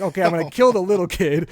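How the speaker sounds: exasperated outburst. Retold or imitated. Dad retelling chaos with both kids, loud mock exasperation. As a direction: blurt it out at the end of your rope. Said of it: "So I'm like".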